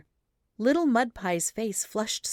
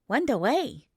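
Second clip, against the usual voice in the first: put-on prompt child answer; 6 semitones higher than usual. A narrator's reading. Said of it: "he answered promptly".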